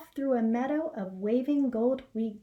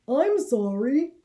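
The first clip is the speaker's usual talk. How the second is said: Harold's voice; 3 semitones higher, 7 dB louder than usual. Acted. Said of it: "said Harold".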